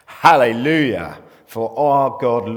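loud, slow, drawn-out hallelujah; shouted hallelujah. A narrator's reading. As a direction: read it like a great crowd shouting.

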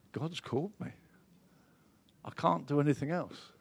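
hushed conviction. Acted. Voicing an inner calling, hushed.

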